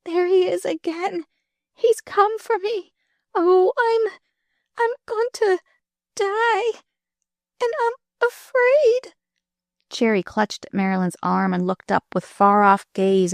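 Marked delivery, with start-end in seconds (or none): 0.0-9.1 very high, terrified cries; terrified scream. Acted. Dying girl cries out in terror at death. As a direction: shriek it out in terror. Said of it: "Cherry screamed out".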